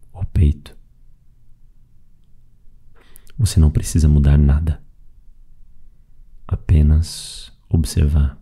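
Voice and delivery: calm, even meditation voice with long pauses; guided meditation. Acted. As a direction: guide them gently through the breathing, leaving space after each phrase. (meditação guiada, voz calma e pausada)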